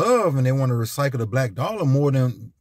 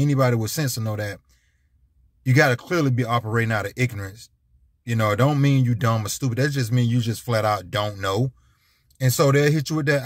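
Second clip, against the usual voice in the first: ordinary talk, nothing marked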